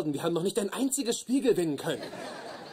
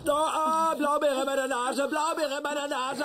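panicked; 7 semitones higher than usual. Acted. Blueberry up his nose.

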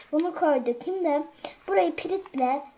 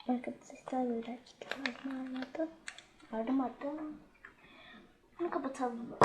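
softer and pulled back; not shouting now; 12 dB quieter than usual. Real, not acted. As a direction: bring the volume well down. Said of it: "Şimdi birazcık bağırmıyorum".

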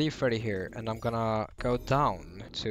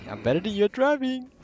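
taunting, higher and louder; sing-song brag, higher pitch; mocking high taunt; 11 semitones higher, 5 dB louder than usual. Mocking repeat.

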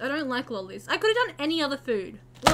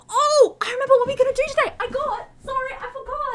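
excited realization; 9 semitones higher, 4 dB louder than usual. Real, not acted. Sudden recall, higher pitch.

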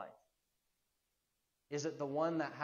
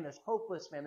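usual speaking voice

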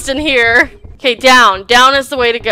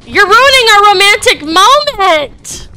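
mock outrage; 8 semitones higher, 4 dB louder than usual.

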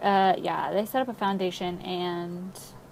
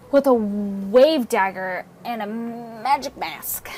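slow, ominous cult-villain flourish; hammed-up drama, words stretched; mock dramatic; 4 dB louder, slower than usual. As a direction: draw it out with theatrical menace.

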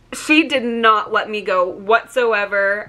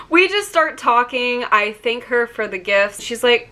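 ordinary talk, nothing marked

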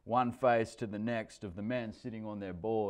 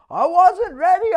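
put-on upset child; 16 dB louder than usual. Child crying after wipeout.

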